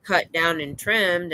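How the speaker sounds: ordinary talk, nothing marked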